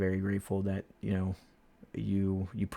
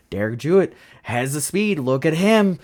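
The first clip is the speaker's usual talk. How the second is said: imitated commentator hype, higher and louder; excited race broadcaster; 5 semitones higher, 8 dB louder than usual. Broadcasters getting on the driver who has speed, mimicked excitement. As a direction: build to a loud, rising "look at him". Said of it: "they'll get on me and".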